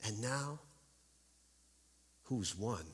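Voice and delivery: soft, quivering witch voice; a trembling, hushed taunt. Acted. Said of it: "in a quivering voice".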